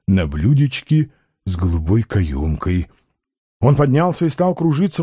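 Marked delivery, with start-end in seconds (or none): 0.1-2.9 low, doltish-dreamy character voice; trusting Balaganov. A narrator's reading. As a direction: repeat it low, savouring the promise. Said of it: "Балаганов доверчиво усмехнулся". (доверчиво, низким голосом)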